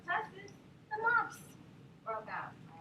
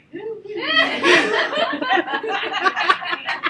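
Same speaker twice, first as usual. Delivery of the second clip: long loud laughter; 8 semitones higher, 16 dB louder than usual. Real, not acted. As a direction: crack up loudly. Laughs at son story.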